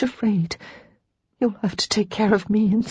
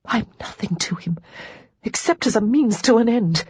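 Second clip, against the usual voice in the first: high, shaking, half-whispered reply; a wavering, hurt answer; shakily; 5 semitones higher than usual. Acted. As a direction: let the voice tremble. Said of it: "before replying shakily".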